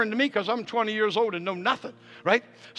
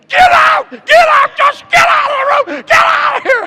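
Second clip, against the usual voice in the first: very high, very loud screech; imitated furious scream; 15 dB louder than usual. Wife in labor throwing husband out, imitated screaming. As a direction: scream it at full force, as the enraged wife. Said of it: "she's screaming".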